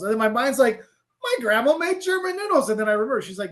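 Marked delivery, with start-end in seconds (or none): 1.2-2.7 very high-pitched mock-amazed inner voice; retold astonished realization. Traveler replaying his realization about grandma's German noodles, astonished. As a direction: act out the penny dropping, pitch way up.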